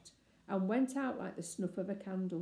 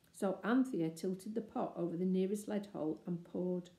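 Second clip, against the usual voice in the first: ordinary delivery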